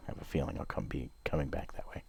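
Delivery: quick soft whisper. Real, not acted. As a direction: breathe it out uneasily. Creeped out, whispering.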